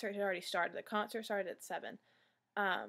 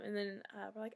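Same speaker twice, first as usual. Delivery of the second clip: ordinary talk, nothing marked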